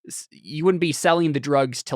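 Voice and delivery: ordinary delivery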